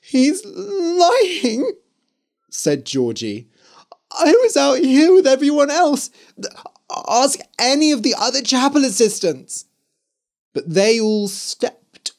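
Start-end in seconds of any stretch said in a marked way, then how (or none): 0.0-1.7 high, tearful cry of denial; crying protest. Acted. Georgie crying to the crowd that he lies. 4.1-9.7 tearful plea. Acted. Georgie pleads her innocence.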